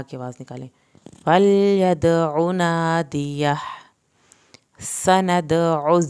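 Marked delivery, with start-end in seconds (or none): 1.2-3.5 slow, louder chant; slow recitation. Shown as a demonstration. Teacher to students: slow recitation. 4.8-6.0 "سندع" very slow, bouncing the dal, hissing s; dal qalqala. Shown as a demonstration. Teacher to students: very slow, louder. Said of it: "دال کا قلقلہ کرنا ہے".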